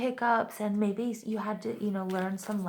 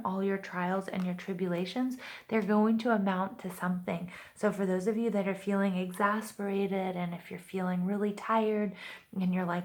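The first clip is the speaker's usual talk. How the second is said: ordinary talk, nothing marked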